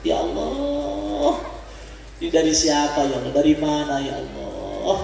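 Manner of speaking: long-drawn cry to God, then a low murmured plea; imitated grateful weeping. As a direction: act gently overwhelmed by an unexpected blessing. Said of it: "saking memperlihatkan kesenangannya kepada". (meniru doa haru penuh syukur)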